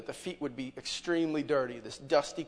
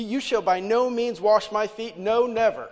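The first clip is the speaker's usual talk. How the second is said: loud, slow, higher, near-violent refusal; emphatic refusal; 6 semitones higher, 9 dB louder than usual. Acted. Peter flatly refusing his Lord, loud and emphatic. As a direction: make "by no means" and "No, never" land like a blow. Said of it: "The Greek is so emphatic".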